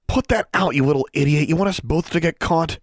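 high, agitated telling-off; urgent scolding. A narrator's reading. Girl scolding a boy over his lit wand, urgent. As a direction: scold urgently with the pitch pushed up.

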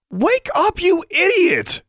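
very high, loud, slow furious yell; shouting insults at a sleeping roommate; angry shout. Acted. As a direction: bellow her awake. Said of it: "Alexandra shouted".

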